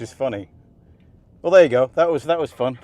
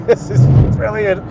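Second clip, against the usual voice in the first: higher and louder, thrilled; excited; 8 semitones higher, 7 dB louder than usual. Real, not acted. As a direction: yell it with delight. Thrilled driver on hot lap.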